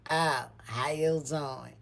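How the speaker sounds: slow, drawn-out cool greeting; changed attitude. Retold or imitated. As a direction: imitate a suddenly unimpressed hello. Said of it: "her whole attitude changed".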